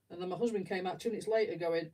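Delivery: usual speaking voice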